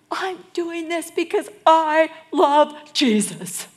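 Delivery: loud, high, slow protest; indignant self-defence. Acted. Mary defending herself to the disciples, loud indignant protest. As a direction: proclaim it with wounded righteousness.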